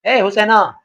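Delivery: loud call to his wife. Acted. Servant calling out to his wife from outside. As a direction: call her name out from the doorway.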